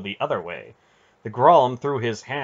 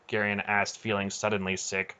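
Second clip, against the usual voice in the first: ordinary delivery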